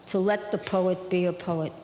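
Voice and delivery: measured, rhythmic poetry recital; poem reading. Acted. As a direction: read it like a poem to an audience. Reads her poem aloud.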